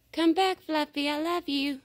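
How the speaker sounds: calling out. Real, not acted. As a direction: shout after it to come back. Calling the pet to come back.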